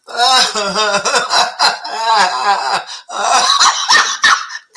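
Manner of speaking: rising, breathless laugh; helpless laughter. Real, not acted. Host laughing too hard to respond. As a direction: laugh till you can't talk. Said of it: "I'm laughing too much".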